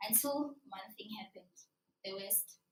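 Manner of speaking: hushed, breathy storyteller's whisper; dramatic whisper. Acted. Storyteller hushes the audience before the twist, whispering. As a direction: drop to a whisper to build suspense.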